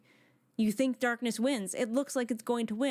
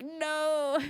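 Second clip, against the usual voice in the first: a higher, pointed little "No"; flat refusal; 6 semitones higher than usual. Retold or imitated. Retelling her fanfic, the heroine refuses him with a short higher no. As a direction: shut him down in one word. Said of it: "And she's like".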